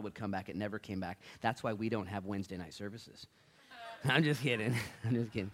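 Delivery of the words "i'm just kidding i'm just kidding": chuckling through the words; real laugh breaking around the line. Real, not acted. Laughing at own joke.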